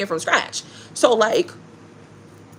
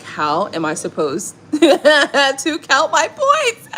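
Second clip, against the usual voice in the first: laughing mock dismay; 5 semitones higher, 4 dB louder, much slower than usual. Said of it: "I was like".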